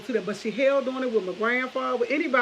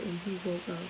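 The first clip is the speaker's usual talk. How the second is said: ordinary talk, nothing marked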